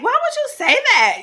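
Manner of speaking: high, loud mock-scandalised question; retold shocked protest. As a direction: re-enact calling out a coworker, loud and high. Retells own protest to coworker.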